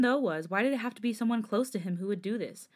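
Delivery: plain reading voice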